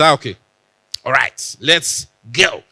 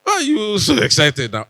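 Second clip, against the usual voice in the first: higher-pitched mock wondering; lightly acted, higher questioning voice; imagined puzzled caller; 6 semitones higher, 3 dB louder than usual. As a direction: ask it like someone baffled by the excitement. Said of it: "they would have been wondering".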